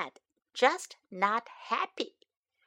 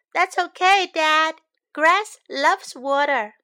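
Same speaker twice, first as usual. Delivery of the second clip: put-on cheerful child; 5 semitones higher, 6 dB louder than usual. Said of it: "I say".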